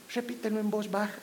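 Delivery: softly voiced Facebook prayer; soft and sweet, teasing the Facebook posts; mock soft voice. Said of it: "No como esas cosas que aparecen en Facebook".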